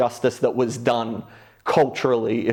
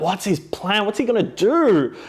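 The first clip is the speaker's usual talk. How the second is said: higher mock-suspicious questioning; suspicious wondering; 8 semitones higher than usual. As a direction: wonder aloud what he's plotting. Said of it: "I'm like".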